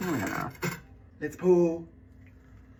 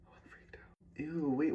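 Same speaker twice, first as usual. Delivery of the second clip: whispered; 3 semitones higher, 7 dB quieter than usual. Real, not acted. Startled by the can.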